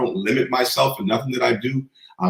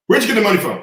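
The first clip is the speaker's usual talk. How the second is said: stern father; 8 semitones higher, faster than usual. Retold or imitated. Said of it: "He said".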